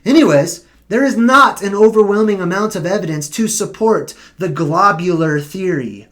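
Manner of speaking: usual speaking voice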